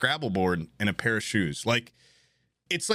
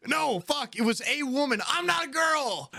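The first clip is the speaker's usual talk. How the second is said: indignant yell. Real, not acted. Correcting herself, indignant.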